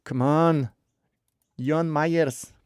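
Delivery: usual speaking voice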